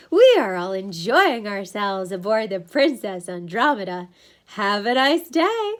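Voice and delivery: slow, loud, programmed recital; glazed crew member. A narrator's reading. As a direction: recite a welcome as if hypnotized. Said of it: "his eyes glazed".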